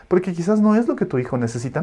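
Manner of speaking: ordinary talk, nothing marked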